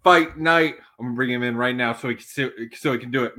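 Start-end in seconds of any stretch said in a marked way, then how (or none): none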